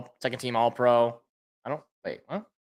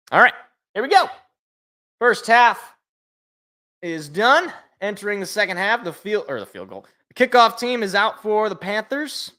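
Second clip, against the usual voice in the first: announcer voice, higher and louder, pumped for the second half; hyped sportscaster opening the half; play-by-play call; 5 semitones higher, 5 dB louder than usual. Acted. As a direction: call the kickoff like a TV broadcaster. Calls the game restart.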